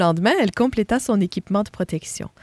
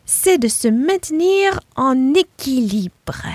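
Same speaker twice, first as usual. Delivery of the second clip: slow, higher piglet voice stressing balance; careful explanation; 5 semitones higher, slower than usual. Acted. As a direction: spell it out slowly like a lesson. Rosie explaining her plan.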